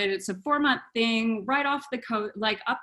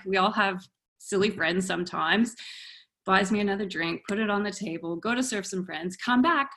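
ordinary delivery

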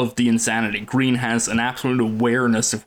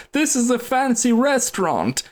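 mock shout; 11 semitones higher than usual. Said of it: "just shouts out".

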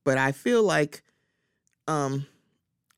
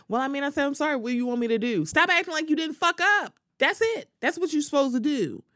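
heated imagined reply; 9 semitones higher, faster than usual. Retold or imitated. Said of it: "And then it's like".

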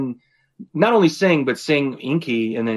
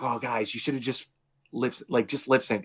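softly, as if embarrassed for them; hushed, sympathetic mock advice; pitying aside; 8 dB quieter than usual. Imagined advice to struggling singers.

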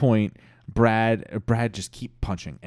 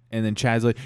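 plain reading voice

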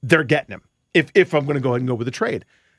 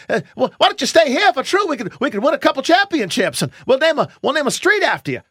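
wooing sales pitch; 10 semitones higher, 4 dB louder than usual. Retold or imitated. Wooing a star player to stay.

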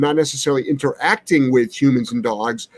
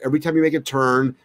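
ordinary delivery

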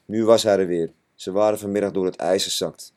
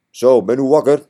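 hearty farmer; 5 semitones higher, 9 dB louder than usual. Acted. Farmer opens door, greets boys.

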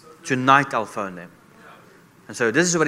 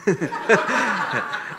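high-pitched laugh after his own joke; real laugh; 9 semitones higher than usual. Real, not acted. Joking reply to plane offer.

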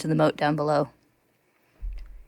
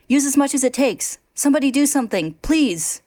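higher, louder desperate plea; desperate shout; 8 semitones higher, 4 dB louder than usual. A narrator's reading. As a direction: beg anyone to help. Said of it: "he shouts".

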